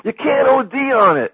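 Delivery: very high, loud exasperated mock shout; Sam's retort. Sam knocks down Dewey's fears, high insistent shout. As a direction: shout the rebuttal at the top of the range. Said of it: "and then Sam goes".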